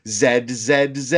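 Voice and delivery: "Z" repeated as "zed", each ending in a d. Retold or imitated. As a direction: chant "Z" as zed, imitating the kids' show. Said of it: "so they'll do, you know, the A B Cs".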